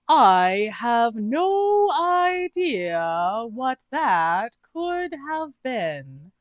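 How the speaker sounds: high, very slow, overly innocent; a bad liar stalling; [name]'s voice. [name] lies to Ao, high and drawn out.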